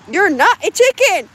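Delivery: loud, high mock scold at a hen; teasing shout at a stubborn hen. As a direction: call out the hen in a big teasing voice. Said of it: "And this is how it".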